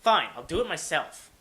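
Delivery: ordinary talk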